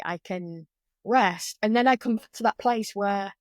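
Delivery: usual speaking voice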